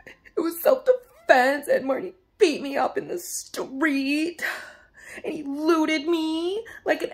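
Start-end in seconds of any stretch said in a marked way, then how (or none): none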